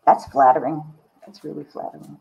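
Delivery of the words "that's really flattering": a soft whisper under the breath; whispered aside. Real, not acted. Alone on the call while the friend fetches the painting, whispered. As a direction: murmur it to yourself, touched.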